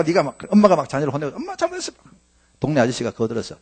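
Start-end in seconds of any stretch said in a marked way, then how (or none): 1.4-1.9 put-on much higher, soft little-child voice; child's plea. A scolded child begging mom's forgiveness, tiny high voice. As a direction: squeak it out like a small kid.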